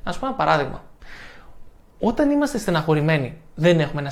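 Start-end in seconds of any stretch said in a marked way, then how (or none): none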